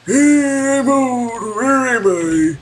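put-on high, slow, creaky old person voice. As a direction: play a doddering old-timer, loud and lost. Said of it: "That's my old person voice".

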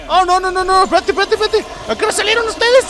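very high, frantic squeal; panicked outburst. Real, not acted. Player surprised by enemies appearing, panicked squeal. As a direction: rush it out in high-pitched panic.

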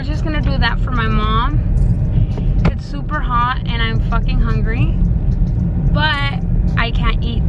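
speaking around new braces; slight lisp from brackets; talking with braces. Real, not acted. Seen 2: Metal brackets on her teeth are visible while she talks, clearest at 7–8s.

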